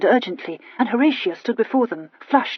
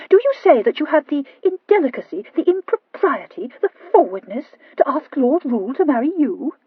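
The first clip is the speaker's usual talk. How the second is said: scandalised; 6 semitones higher, 3 dB louder than usual. A narrator's reading. Sister scandalised.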